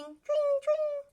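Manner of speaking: high, loud ringing tring; bell tring. Shown as a demonstration. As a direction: ring it out high like a bike bell. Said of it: "A bicycle bell ringing".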